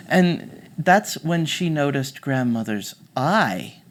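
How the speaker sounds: ordinary delivery, nothing marked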